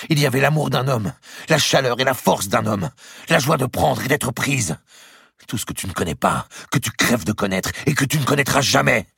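high, impassioned outpouring; urgent, panting. A narrator's reading. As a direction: pile up phrases heatedly at your rival. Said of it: "d'une voix pressée, haletante".

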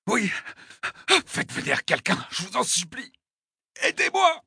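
high gasps rising to a cry; desperate pleading. Acted. Wounded attacker begging for someone to come, desperate. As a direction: beg for help with failing breath. Dying man begging for help.

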